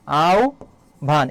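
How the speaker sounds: আও, a short pause, then ভান; syllable-by-syllable pronunciation. Shown as a demonstration. Teacher asks how আহ্বান is pronounced, answers in split syllables. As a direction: split it as "au - bhan". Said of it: "এর উচ্চারণটা কি হবে বলো তো".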